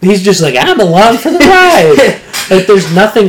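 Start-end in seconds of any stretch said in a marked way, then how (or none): 0.6-2.2 high, loud, slow goofy cheer; hammed-up happy tagalong; mock carefree. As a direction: sing out with exaggerated glee. Mocking Peter as carefree tagalong.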